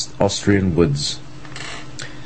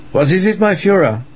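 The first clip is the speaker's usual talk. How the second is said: high eager servant voice; 12 semitones higher, 3 dB louder than usual. Acted. Dietrich answers his master.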